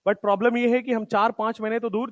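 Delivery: usual speaking voice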